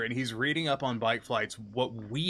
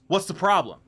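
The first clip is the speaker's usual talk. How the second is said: aggressive; 6 semitones higher, 5 dB louder than usual. Retold or imitated. Customer to shop staff checking the regulations, aggressive. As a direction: imitate an aggressive customer, higher and louder. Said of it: "he says it aggressively".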